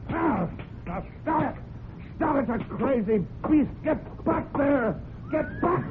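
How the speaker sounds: high, frantic cries to stop; panicked shouts. Acted. Trainer trapped in the barn, yelling at the crazed horse in high panic. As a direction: scream at a horse gone wild.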